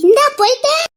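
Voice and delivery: loud, high-pitched shout back; calling out. Acted. Villager answering as they hurry off, called out loudly. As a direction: call it out on your way off.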